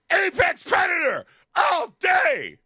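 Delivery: high, loud, slow mock-heroic yell; triumphant shout. Daughter in the boat shouting her triumph, high and loud. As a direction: belt it like a movie hero's victory cry.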